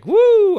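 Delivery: sudden high-pitched "woo"; loud high whoop. Real, not acted. Exclaims about edging.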